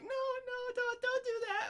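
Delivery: put-on high pleading girlfriend. Girlfriend begs him not to launch the dog, high and pleading. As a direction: beg in a high squeaky voice. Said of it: "she'll probably be like".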